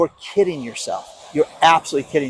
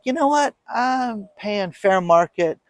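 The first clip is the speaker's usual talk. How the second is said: proud friend quote; 6 semitones higher, slower than usual. Retold or imitated.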